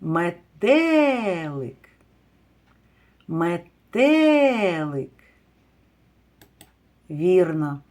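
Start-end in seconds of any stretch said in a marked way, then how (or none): 0.0-5.1 "Метелик" called slowly with a drawn-out stressed second syllable; calling out the word. Shown as a demonstration. Teacher calling the word to find stress, "Метелик" called out slowly. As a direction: call "Метелик" loudly and slowly, leaning on the stress. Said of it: "Гукайте слово".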